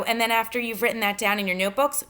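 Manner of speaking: normal talking voice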